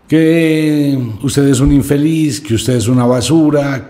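loud and high, spitting out the insults; bitter loud insults. Retold or imitated. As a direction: hurl each insult as if it stings. Boy repeats insults thrown at him.

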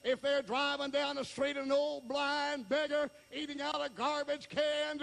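high, driving pulpit delivery; insistent revival preacher; full-force preaching. Real, not acted. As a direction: press on in a high preaching cadence.

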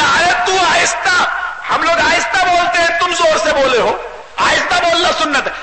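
high, strained scolding; heated rebuttal. Acted. Imam argues back in quarrel.

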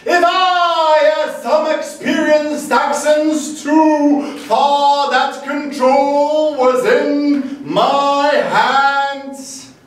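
half-sing it with a Texan swagger; greasy Texan poem. Acted. Poet reciting his horse-ride poem, loud and half-sung. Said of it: "to be read in a greasy Texan accent".

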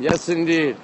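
normal talking voice

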